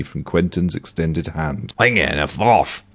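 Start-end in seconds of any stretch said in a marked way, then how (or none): 1.8-2.8 gruff Scottish tramp mumbling; dismissive Scottish tramp rolling away. Acted. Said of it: "said the tramp".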